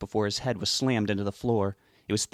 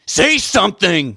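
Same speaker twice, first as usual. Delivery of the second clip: slow, much higher, furious scream; 10 semitones higher, 12 dB louder, slower than usual. A narrator's reading. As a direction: bellow it slowly at full force. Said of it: "he screamed".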